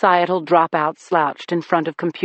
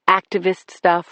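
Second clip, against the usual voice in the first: ordinary delivery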